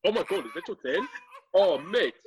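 high-pitched mock South African accent. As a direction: play it shocked in a put-on South African accent. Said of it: "South African accent, please".

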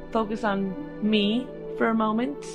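usual speaking voice

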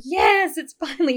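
high, loud burst of joy; excited. Retold or imitated. Mom celebrating her finished blog, excited yelp. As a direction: cheer like the piece is finally done. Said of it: "I did a little happy dance".

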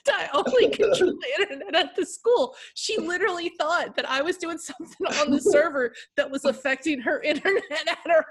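high, loud, laughing through the words; can't stop laughing as you tell it; laughing while talking. Real, not acted. Retelling, laughing at the story.

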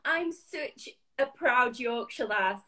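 ordinary talk

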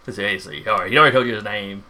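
ordinary talk

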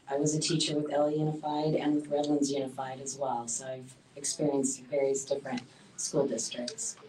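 usual speaking voice

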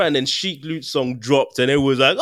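usual speaking voice

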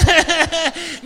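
loud, high laughter; real rapid chuckling; hearty laugh. Real, not acted. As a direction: burst out laughing mid-sentence. Laughs at his own joke.

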